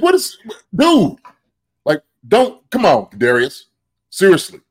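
high, exasperated What is, dude; retold outburst. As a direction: scold the player in disbelief. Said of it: "I'm going crazy on, on that".

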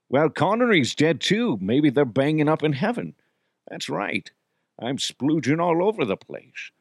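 put-on slurred, lewd old Scottish star; Connery impression. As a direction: do a comic Connery voice. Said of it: "the dirtiest character that I do".

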